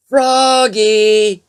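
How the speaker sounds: high, loud call across the house; father calls his son, drawn out. A narrator's reading. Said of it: "called his father".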